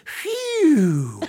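high, loud sigh of relief; mock relief exhale; relieved phew. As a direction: blow out a big relieved phew. Urethra voiced in punchline.

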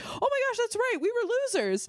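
high-pitched reenacted dismay at remembering; squeaky mock realization; retold sudden realization. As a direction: jump up high, quoting your own embarrassed reaction. Retelling her reaction to text.

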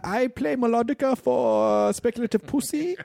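put-on slow, high-pitched German accent; mischievous German. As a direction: impish German, drawn out and high. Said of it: "it's, like, mischievous German".

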